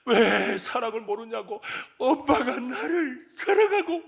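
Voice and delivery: put-on loud, high child's tantrum wail, drawn out; acted tearful wailing. Frustrated boy crying to his mother who cannot understand, wailing loudly. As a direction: build from a drawn-out whine to a hammed-up crying shout. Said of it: "철수가 막 울기를 시작".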